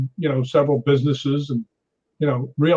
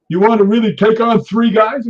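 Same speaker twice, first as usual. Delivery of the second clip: loud, higher imitation of a taunt; mock incredulous challenge; 7 semitones higher, 7 dB louder than usual. Drunk intruder daring the lone man, mock challenge. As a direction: challenge him as a drunk would, acted.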